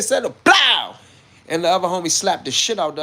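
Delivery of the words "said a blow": high, loud "blow" for the slap; imitated blow. Storyteller mimicking the slap at the climax, imitated blow. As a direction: burst out with the smack sound.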